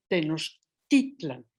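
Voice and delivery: "Tenochtitlan" slow, stress on "tit": te-noch-TIT-lan; "Tenochtitlan" said slowly, stressed on the next-to-last syllable; penultimate stress. Shown as a demonstration. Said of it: "con acento en la penúltima sílaba".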